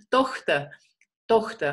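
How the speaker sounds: Tochter with a throaty German ch, like a rasp at the back of the throat; Tochter, the ch scraped at the back of the throat. Shown as a demonstration. Teacher shows students the ch sound in Tochter. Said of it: "La C H en alemán es".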